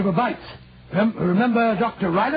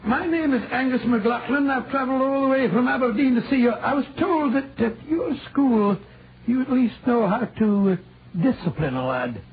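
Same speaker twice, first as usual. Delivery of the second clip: a light put-on Scottish accent, rolling the r's; 2 semitones higher than usual. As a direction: play a canny Scot from Aberdeen, lightly. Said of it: "that delightful Scottish accent you sometimes assume".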